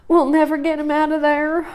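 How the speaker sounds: high, hopeless mother moaning. Acted. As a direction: give up with a moan. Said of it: "moaned their mother".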